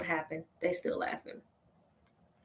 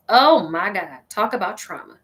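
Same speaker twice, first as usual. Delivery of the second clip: usual speaking voice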